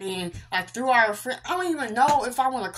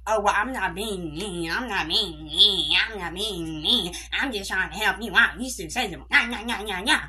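whiny sing-song mimicry, words dragged out; mock her excuses in a put-on voice; childish taunting imitation; mocking friend. Mocks friend's excuses in a retelling.

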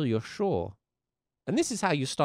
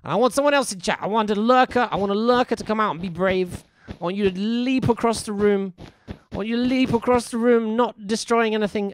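higher, louder, rousing plea to lurkers; rallying call; 8 semitones higher, 8 dB louder than usual. Real, not acted. Calling out to lurkers.

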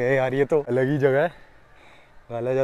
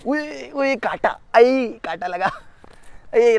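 ouch from thorns; 8 semitones higher, much slower than usual. Real, not acted. Pricked by thorns.

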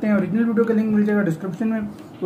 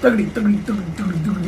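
rhythmic clip-clop syllables; imitated hoofbeats. Joking that the robot is a horse, imitating hoofbeats. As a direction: mimic a trotting horse in repeated syllables.

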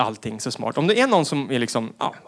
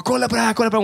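higher, eager daughter voice; higher teen insisting dad look; 6 semitones higher, much faster than usual. Retold or imitated. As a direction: push the clip at dad excitedly. Teen daughter showing clips.

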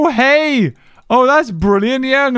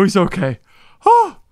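usual speaking voice

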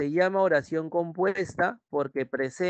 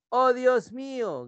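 high, drawn-out exclamation; mock cry out; 8 semitones higher, slower than usual. Shown as a demonstration. Teacher models an exclamation for students, mock crying out. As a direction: cry it out slowly, pitched high. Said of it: "gritas. Exclamación".

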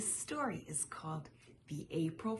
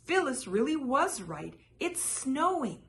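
higher and louder, calling down; yelling; 8 semitones higher, 5 dB louder than usual. A narrator's reading. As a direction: yell it down the burrow. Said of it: "Pete yelled down".

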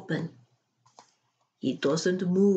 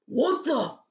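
demanding; 8 semitones higher than usual. Acted. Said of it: "he demands".

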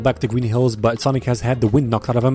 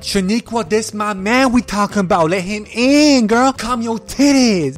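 high, slow, sassy put-on woman's voice; sassy yoga lady; 7 semitones higher, slower than usual. Yoga lady telling her friend to let him in, high and sassy. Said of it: "Yoga Lady comes over like".

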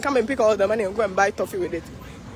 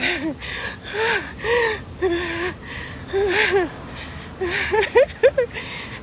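high, wheezy panting into laughter; pant, then break into laughing; breathless laugh; 7 semitones higher, 3 dB quieter than usual. Real, not acted. Worn out on the walk.

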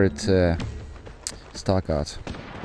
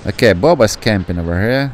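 ordinary talk, nothing marked

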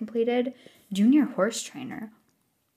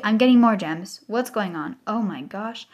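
usual speaking voice

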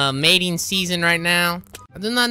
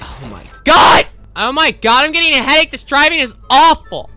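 loud, higher exasperated outburst; loud complaint; 8 semitones higher, 9 dB louder than usual. Acted. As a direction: yell at the terrible driver. Selena complains about bad driving.

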